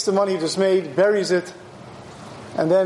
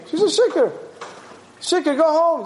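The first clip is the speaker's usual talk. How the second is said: sharply higher-pitched retold scolding; indignant rebuke; 8 semitones higher than usual. Eli decides she's a shikar, scolding her. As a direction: dismiss her in mock indignation.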